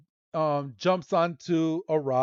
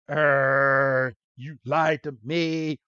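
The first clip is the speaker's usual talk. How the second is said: drawn-out growl into an accusation; hammed-up simmering anger, held back in volume; mock outrage; much slower than usual. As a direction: imitate someone fuming at a liar. Acts Misty angry at lie.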